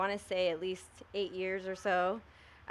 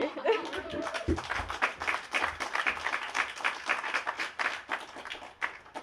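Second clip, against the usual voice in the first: a long, airy laugh of relief; long breathy laugh; 12 semitones higher than usual. Real, not acted. Veteran friend laughs after urging the crowd to vote, breathy laugh.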